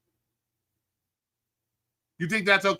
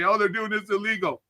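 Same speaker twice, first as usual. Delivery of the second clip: mocking whiny complainer. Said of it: "you wanna cry about it and say".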